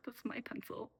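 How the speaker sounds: whispered, small and scared; meek whispered plea; timid whisper. Retold or imitated. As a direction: barely breathe it out like a shy five-year-old. Child self timidly claiming pencil.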